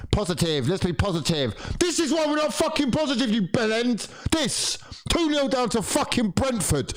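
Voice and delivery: high angry tirade at the team; furious rant. Real, not acted. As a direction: let it all out. Fan rants at team.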